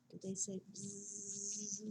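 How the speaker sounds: usual speaking voice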